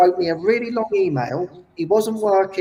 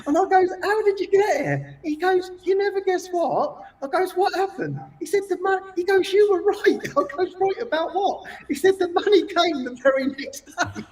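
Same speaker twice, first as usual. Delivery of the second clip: high, bubbling storyteller voice pitched way up; excited retelling; 11 semitones higher than usual. Retold or imitated. Host retelling the miracle guest story to David, high and excited. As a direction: tell it like you can barely contain the joy.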